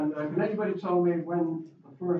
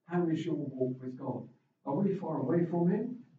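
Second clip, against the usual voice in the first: ordinary talk, nothing marked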